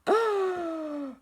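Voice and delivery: sharp startled gasp. Acted. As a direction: gasp in shock. Sees thing in sea.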